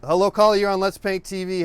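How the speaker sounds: loud, high talk-show host welcome; radio host greeting. Acted. Host greets call-in caller.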